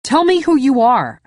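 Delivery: loud, high, hard demand; angry demand. Acted. Narrator demands the intruder's name, loud angry order. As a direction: push him for an answer.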